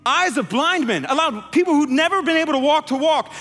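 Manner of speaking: loud, high, impassioned preaching; preaching at full force. Real, not acted. Preacher pleading to the congregation, full force. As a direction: build to a fervent climax.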